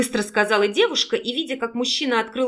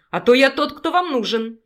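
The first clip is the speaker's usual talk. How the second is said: plain reading voice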